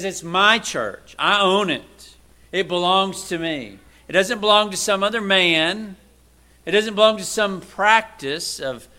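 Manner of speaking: fervent emphatic preaching; preaching at full force. Acted. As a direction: hammer home whose church it is. Preacher insists on ownership.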